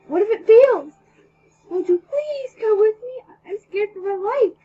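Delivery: high, loud, pleading yell. A narrator's reading. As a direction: cry it out, high and loud, as if scared. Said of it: "I yelled and mumbled in reply".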